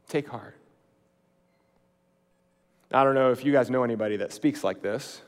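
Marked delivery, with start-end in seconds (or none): 0.1-0.6 softer echo, letting Take heart sink in; softly repeated. Shown as a demonstration. Preacher savouring the phrase, repeated softly. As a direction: murmur it back gently.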